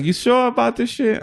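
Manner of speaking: Israeli friend impression. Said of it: "He's like".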